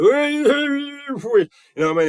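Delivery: long, very high mock wail; loud sustained high-pitched noise; imitated high squeal. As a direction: imitate a startled reaction with a drawn-out squeal. Imitates the Father's startled reaction.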